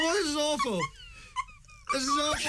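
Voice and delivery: laughing distress. Real, not acted. Tissue in nose, eyes held.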